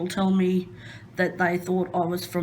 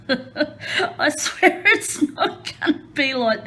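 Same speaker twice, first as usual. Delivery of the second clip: laughing; 7 semitones higher, 4 dB louder, slower than usual. Real, not acted. Embarrassed promise to viewers.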